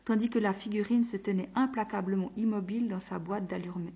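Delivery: ordinary delivery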